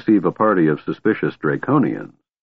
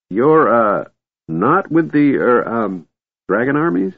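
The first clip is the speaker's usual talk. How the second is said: put-on high, halting, uncertain old voice; hesitant old wizard; 4 semitones higher than usual. A narrator's reading. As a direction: grope for the words nervously. Fizban unsure, hesitating.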